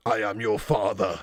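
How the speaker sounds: low, slow mock-villain voice; deep, drawn-out parody father reveal; Darth Vader style. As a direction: drop low and draw it out like a movie villain. Darth Vader quote read out.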